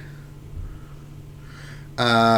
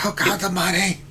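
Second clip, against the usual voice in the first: imitating Urz; 7 semitones higher than usual. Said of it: "That's what I love about Urz".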